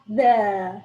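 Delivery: playful drawn-out British vowel; put-on British accent. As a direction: practise a British "The", exaggerated. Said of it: "try to speak with an accent".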